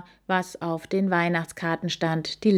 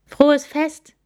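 higher and louder festive call; called greeting; 9 semitones higher, 8 dB louder than usual. Acted. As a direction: shout a friendly wish. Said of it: "rief er". (laut gerufen)

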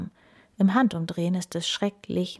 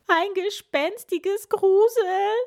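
high, loud, slow panicked chant; chorus of fright; 13 semitones higher, 5 dB louder, slower than usual. A narrator's reading. All the animals crying out in fear, high loud slow. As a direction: wail it together in fright. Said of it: "rufen alle im Chor".

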